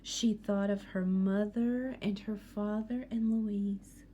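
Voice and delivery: usual speaking voice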